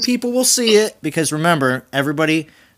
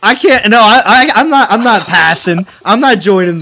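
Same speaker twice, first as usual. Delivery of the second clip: loud protest; 5 semitones higher, 7 dB louder than usual. Real, not acted. Protesting high-pitched voice joke.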